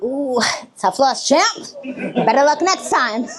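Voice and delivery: slow, smug fake sympathy; condescending mock consolation; smug taunt. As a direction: rub it in sweetly. Mocking the losing coaches.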